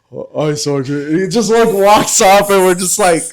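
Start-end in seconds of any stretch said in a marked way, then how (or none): 0.1-1.1 lightly imitated dim-witted soldier; a hint of a simple, obedient private; Chavez. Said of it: "that's how he talked".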